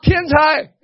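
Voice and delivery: high, loud call across the house. Shown as a demonstration. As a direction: call the kid to dinner. Calling child to dinner.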